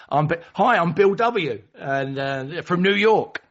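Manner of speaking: high, loud, keen self-introduction; eager Bill W. Retold or imitated. Bill W introducing himself to the meeting, eager and loud. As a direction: announce yourself, proud to be known.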